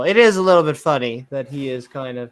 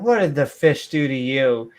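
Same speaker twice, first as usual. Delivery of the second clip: usual speaking voice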